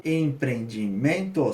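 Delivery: "Empreendimento" stretched out slowly, syllable by syllable; em-pre-en-di-men-to said slowly by syllable. Shown as a demonstration. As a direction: spell out "Empreendimento" in slow beats. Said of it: "seis, então polissílabas".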